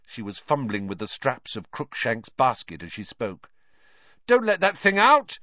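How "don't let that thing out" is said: urgent warning. A narrator's reading. Ron warns about the cat.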